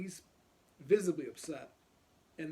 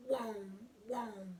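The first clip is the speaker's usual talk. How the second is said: engine revving; 6 semitones higher than usual. Retold or imitated. Imitating the bike revving.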